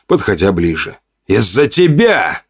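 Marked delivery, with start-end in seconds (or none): 1.3-2.4 slow, higher-pitched accusation; starting to seethe. A narrator's reading. Hunter blaming the narrator, starting to seethe. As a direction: draw out the blame, anger rising. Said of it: "начал он закипать".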